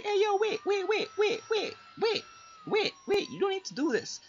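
high, frantic repeated wait; panicked pleading. Retold or imitated. Gamer retelling his match, pleading high and frantic.

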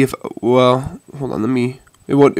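usual speaking voice

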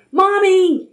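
put-on high, loud child owl's call. A narrator's reading. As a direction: call urgently for mother. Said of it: "he called".

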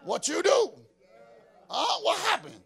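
loud, half-laughing fatherly demand; a father pressing his child, playfully; daddy grilling the kids, half-laughing; dad confronting child. Retold or imitated. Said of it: "I say".

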